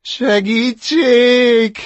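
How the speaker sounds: very high, loud, drawn-out panicked cry; cry for help. Acted. Drowning chick calling for help, panicked. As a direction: scream for help while sinking.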